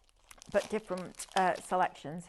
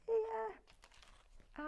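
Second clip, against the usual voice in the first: small, high, softer voice; retold sheepish reply; 7 dB quieter than usual. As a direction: answer high and soft, playfully owning up. Retold reply to husband's complaint.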